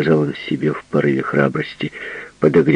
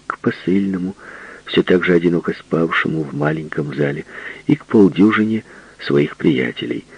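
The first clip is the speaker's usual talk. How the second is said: usual speaking voice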